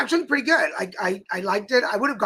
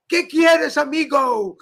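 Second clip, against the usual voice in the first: higher, louder attempt at Indian-accented Spanish; imitated Indian-accented Spanish; 5 semitones higher, 4 dB louder, slower than usual. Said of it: "it's a very unique accent".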